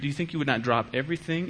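ordinary delivery

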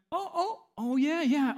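high sudden oh of a man caught off guard; mock startled professor.

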